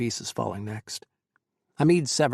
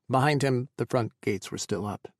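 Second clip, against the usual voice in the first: usual speaking voice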